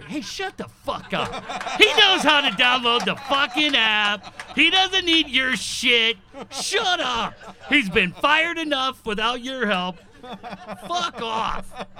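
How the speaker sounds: loud, laughing mock tirade at the woman; mock angry rant. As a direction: tear into her, half laughing. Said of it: "I think he may tell her".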